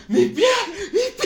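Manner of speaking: high, loud cries of pain; pained shout for his trapped foot; yelling in pain. Retold or imitated. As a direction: yell it like your foot is caught. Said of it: "el gallo comienza a gritar".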